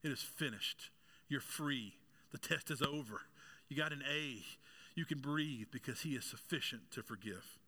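ordinary talk

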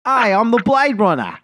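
put-on the South African accent, finally found; nailed South African accent. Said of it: "Gold right there".

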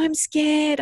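high-pitched imitation of a frightened child; scared child. Daughter confessing fear to mother, imitated scared. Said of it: "then she'd go".

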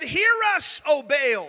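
high, loud cry to Baal; pleading shout upward; calling out. Acted. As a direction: call out desperately to a silent god.

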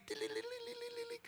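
high, soft tinkling bell sound; little dinner bell jingle; bell imitation. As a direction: ring it like a tiny bell with your voice. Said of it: "la campanita y dice".

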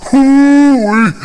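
high, loud country whoop; excited whoop. Acted. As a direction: holler it with glee.

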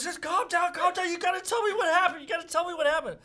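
imitating a frantic father, pitched high; frantic dad pleading. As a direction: plead urgently over the hysterics, voice way up. Dad pleads with hysterical son.

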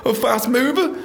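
high, slow mock bouncer voice; mock impressed staff. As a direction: play the fooled doorman, awed and slow. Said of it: "they just think".

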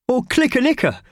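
high, loud silly shout; Mickey yelling a daft place name; gleeful shout. A narrator's reading. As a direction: shout the made-up name with glee. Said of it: "shouted Mickey Thompson".

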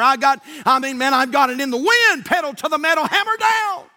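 rising preaching fervor. Acted. Preacher building the sermon illustration, fervent and loud. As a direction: build the speeding tale to a shouted peak.

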